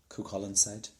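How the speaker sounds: usual speaking voice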